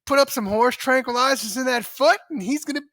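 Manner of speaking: high, loud playing-coy coach voice; coy coach impression. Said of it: "John Payton could have played it coy and been like".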